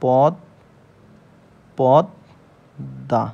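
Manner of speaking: পদ্মা split into পদ and দা with long pauses; syllable demonstration. Shown as a demonstration. Teacher showing how পদ্মা is pronounced, syllable by syllable. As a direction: sound out পদ, then দা, doubling the দ. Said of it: "তার মানে এর বানান হবে হচ্ছে".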